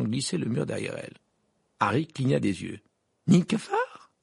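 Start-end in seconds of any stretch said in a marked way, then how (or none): 3.3-4.1 high rising, incredulous question; stupefied. A narrator's reading. Harry seeing the gargoyle suddenly open, stupefied. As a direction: repeat it in disbelief. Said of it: "répéta-t-il stupéfait".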